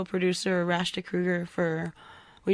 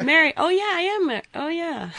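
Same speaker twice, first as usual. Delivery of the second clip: mock ditzy realization; 8 semitones higher, 3 dB louder than usual. Mocking forgetting she's married.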